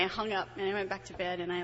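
normal talking voice

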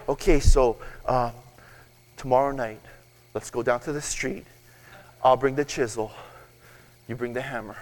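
low, hushed mock scheming; conspiratorial plotting. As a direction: plot it quietly, with pauses between steps. Acted plotting of a gold theft.

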